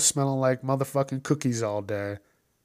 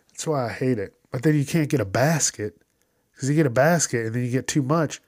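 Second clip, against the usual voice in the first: ordinary delivery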